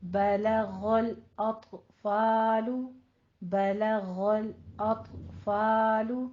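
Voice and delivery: बलगल अतफालु very slowly, thin l's and open a; slow practice model. Shown as a demonstration. Teacher repeats the phrase slowly for students to copy. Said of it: "ख़ास तौर पे आपने practice करनी है".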